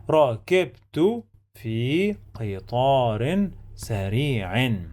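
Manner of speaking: slow, word by word with held vowels; drawn-out model sentence with clear syllables; slow teaching reading. Shown as a demonstration. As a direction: read it out slowly for learners. Teacher models whole sentence for learners.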